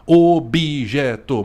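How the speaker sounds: "objeto" drawn out slowly, an i sounded after the b; syllable by syllable. Shown as a demonstration. Teacher showing the hidden vowel, "objeto" slow in syllables. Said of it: "pra manter essa silabicidade".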